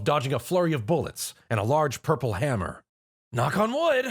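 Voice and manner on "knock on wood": high, bright battle call; yell. A narrator's reading. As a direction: shout it as he activates the watch. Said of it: "he yelled".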